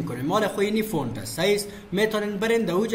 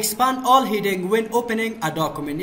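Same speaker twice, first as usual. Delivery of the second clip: reads the option slowly and a bit louder; slow, emphatic reading aloud; slow dictation; 3 semitones higher, 4 dB louder, much slower than usual. Real, not acted. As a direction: spell it out for the class, word by word. Reads an English menu option.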